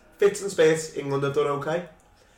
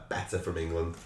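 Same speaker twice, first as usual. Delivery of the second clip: quiet muttered verdict; 8 semitones lower, 10 dB quieter than usual. Retold or imitated. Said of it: "That's always the shout".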